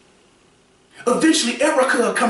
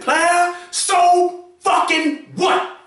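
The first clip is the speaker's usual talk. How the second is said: higher and slow, leaning hard on every word; emphasis on so fucking what; 8 semitones higher, much slower than usual. Real, not acted. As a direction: hammer home "so fucking what" high and slow. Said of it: "I just had to put some emphasis on that".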